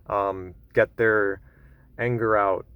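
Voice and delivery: ordinary talk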